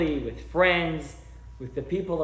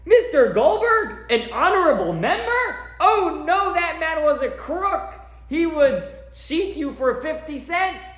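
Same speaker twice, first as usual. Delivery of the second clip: high, loud, indignant shout from the back row; old man's outraged yell; 12 semitones higher, 6 dB louder than usual. Retold or imitated. Old man at the funeral shouting down the rabbi's eulogy, outraged and loud. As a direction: yell it out like a cranky old man who can't stand the praise. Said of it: "he screams out".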